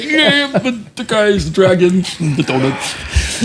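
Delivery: mocking hungover voice.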